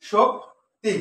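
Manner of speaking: Shakti with a clear gap between its two syllables; first syllable of Shakti, short pause, then the last; split into syllables. Shown as a demonstration. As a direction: break Shakti into two separate beats. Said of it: "শোক হচ্ছে কি একসাথে".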